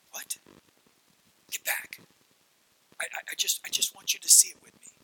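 hushed, urgent whisper at the door; a mother peeking out, keeping kids back; tense whisper. Acted.

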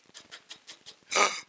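sharp, high, breathy gasp of shock; a child catching sight of Dad; startled gasp. Acted.

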